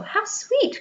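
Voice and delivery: delighted cry. A narrator's reading. Wendy delighted by Peter flying, high cry. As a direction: melt at the sight of him. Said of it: "cried Wendy".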